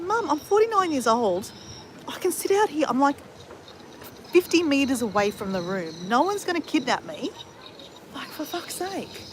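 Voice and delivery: high, exasperated reasoning that sinks to a fed-up groan; annoyed protest. Retold or imitated. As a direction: plead then give up, fully fed up. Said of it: "I was so annoyed".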